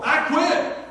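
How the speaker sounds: high, loud imitated shout of "I quit."; mimicking an angry worker walking out; mock angry quit. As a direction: yell it like a worker storming off.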